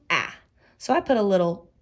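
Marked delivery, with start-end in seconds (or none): 0.1-0.4 short A, said alone as "ah"; short A sound. Shown as a demonstration. Teacher showing what the A says, short A sound. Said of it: "A makes its short sound".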